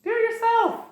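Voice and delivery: very high mock female voice; high put-on voice of the queen snapping back; dismissive queen. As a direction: toss it off in a falsetto brush-off. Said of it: "she's like".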